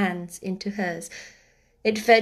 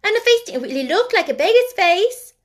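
put-on loud, high child's protest; crying out; 10 semitones higher, 7 dB louder than usual. A narrator's reading. Sister backing up sister, crying out. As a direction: chime in with a raised girlish voice. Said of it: "cried Nora".

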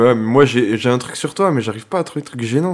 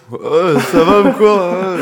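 slow, loud mock drunk slur, higher; 7 semitones higher, 5 dB louder, slower than usual. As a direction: drag it out like a wasted guy. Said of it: "Complètement bourré".

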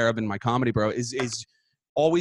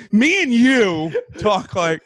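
mock proper; 5 semitones higher, 4 dB louder, much slower than usual. Mock pompous grammar joke.

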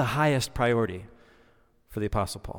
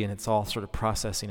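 ordinary talk

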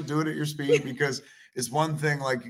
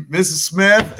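booming call; 7 semitones higher, 12 dB louder, slower than usual. Retold or imitated. EMT calling into room.

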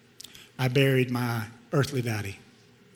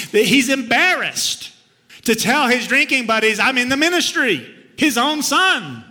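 higher and louder as it builds, slowing at the end; pained pleading, building up; 8 semitones higher, 5 dB louder than usual. Retold or imitated. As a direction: pour out the hurt to the Father, rising to a cry. Pleading complaint to God.

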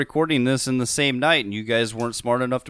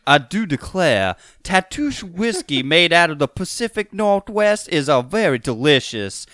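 southern lawyer voice; 2 semitones higher than usual. Shown as a demonstration. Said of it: "Your southern lawyer voice".

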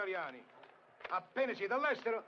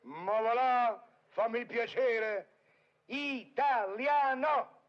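slow, drawn-out scoffing; mocking dismissal; 3 dB louder, much slower than usual. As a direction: drag out Italiano to mock him. Deride il brigadiere. (scandito, beffardo)

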